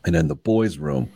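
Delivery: deep-voiced fourth-grade kid, low impression of a son; dad imitating his son's story voice, pitched low; Ted. Son shares his theory with dad, deep story voice. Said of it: "This is my Ted voice".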